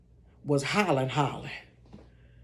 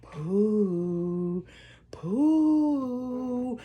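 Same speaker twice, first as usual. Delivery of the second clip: ghostly call; 6 dB quieter than usual. Retold or imitated. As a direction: moan the name slowly like a ghost. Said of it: "I said".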